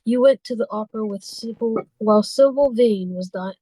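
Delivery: normal talking voice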